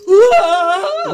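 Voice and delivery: loud cry. Acted. Eric bolts out screaming.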